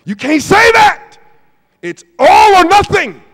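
loud, high preacher declaration; full-force preacher punch; preaching shout. Acted. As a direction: proclaim it to the congregation.

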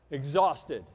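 ordinary talk, nothing marked

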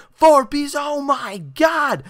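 normal talking voice

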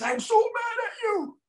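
very high, slow imitated yell; top of his lungs. Retelling the huge client's outburst, high drawn-out yell. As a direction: stretch it out in a mock furious scream. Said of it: "at the top of his lungs".

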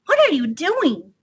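high-pitched hedgehog call; shouted question. Acted. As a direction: call the question over to Otter. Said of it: "shouted Little Hedgehog".

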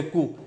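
कू said with silent p, just koo; कू as French koo, p dropped; correct pronunciation. Shown as a demonstration. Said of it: "इसको pronounce करते हैं".

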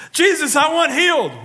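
mock plea for healing; pleading petitioner. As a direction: beg like a sick man. Voicing a petitioner to Jesus.

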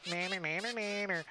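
put-on childish goblin jeer; mock Goblinoid taunt. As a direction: tease in made-up goblin gibberish. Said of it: "He says something in Goblinoid".

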